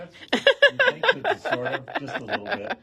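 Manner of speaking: high, laughing words; laughing tease. Real, not acted. Host teasing co-host about her joke, laughing high. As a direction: giggle the teasing question up high.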